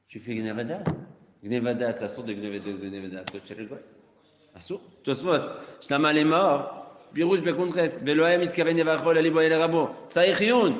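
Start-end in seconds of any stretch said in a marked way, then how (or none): 5.8-10.7 loud, rising, slow-started recitation; teacher reading Rashi with mounting fire; fiery text reading. Acted. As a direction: chant the source louder and higher as it builds. Reads Rashi's words heatedly.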